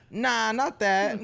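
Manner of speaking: slow, put-on brush-off; mock cool denial. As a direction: imitate a kid playing it cool. Imitating student's denial of falling.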